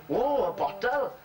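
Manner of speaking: high, drawn-out mock amazement; delighted surprise. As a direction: act out a slow, wondering discovery. Joke character finds a bottle.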